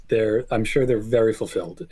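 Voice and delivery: ordinary talk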